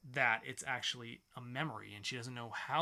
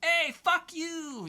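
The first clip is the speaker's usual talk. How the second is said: much higher, louder, slow hammed-up yell; mock yell; 9 dB louder, much slower than usual. Brothers cursing Hank out, hammed-up high yell. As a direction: stretch out a cartoonish angry yell.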